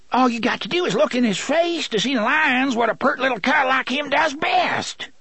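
high, strained pleading; high agitated villain voice; panicky protest. A narrator's reading. Gasher pleads, accusing the boy.

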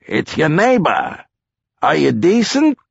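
high, friendly neighbor's call. Acted. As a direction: pretend to be a neighbor popping in. Still calling into the house.

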